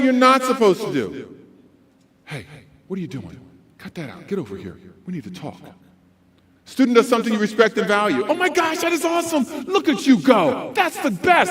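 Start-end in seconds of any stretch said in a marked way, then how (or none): 2.2-5.6 very soft, low, restrained; nighttime DJ reprimand. Shown as a demonstration. Adult to misbehaving student, quiet reprimand. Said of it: "we're using the wrong voice". 8.3-11.5 high, excited gushing; hyped-up praise. Shown as a demonstration. Adult to student who did right, hyped-up praise. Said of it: "Kids love to see adults get hyped up".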